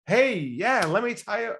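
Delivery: mock salesman launching into a pitch; imitating an eager seller raring to go. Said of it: "that's the game everyone's in".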